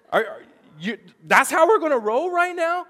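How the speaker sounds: retold outburst at the clerk, loud and high; mad retort. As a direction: throw it back at him like you're fed up. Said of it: "And, and I was mad".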